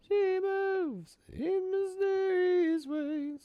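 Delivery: very high, slow falsetto "It's all right"; sing-song it in a tiny high voice, drawn out; falsetto reassurance. Acted.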